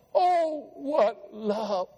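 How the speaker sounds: real tears catching the words; choked up. Real, not acted. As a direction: voice breaking into tears. Overcome while preaching God's love.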